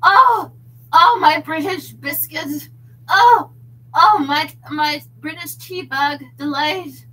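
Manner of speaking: loud, high hammed-up moans; over-the-top British bedroom parody; mock passionate British lover. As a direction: exclaim in comic British ecstasy. Mock British lovers heard through wall.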